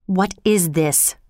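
slow, understated anger; angry. A narrator's reading. Wife confronts her raving husband, restrained anger. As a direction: demand it slowly, mildly angry. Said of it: "Lady Macbeth asked angrily".

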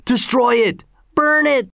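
high, rising eager cries; a hint of a mob calling for fire; angry crowd cry. A narrator's reading. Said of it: "said others".

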